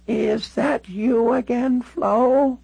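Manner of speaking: put-on high, slow, drawn-out elderly call; a frail ninety-six-year-old calling from another room; very old woman. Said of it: "She looked all of ninety-six years".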